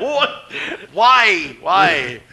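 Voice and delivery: loud, high-pitched mock lament; retold indignant cry, falling in pitch; exasperated disbelief. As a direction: wail the questions in comic outrage. Acting disbelief, unfairness.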